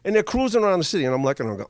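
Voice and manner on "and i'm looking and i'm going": usual speaking voice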